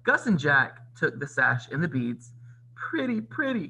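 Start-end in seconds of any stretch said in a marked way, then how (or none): 2.8-3.7 high-pitched little mouse delight; exclaiming. A narrator's reading. Gus the mouse admires the sash and beads, exclaiming high. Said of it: "Gus exclaimed".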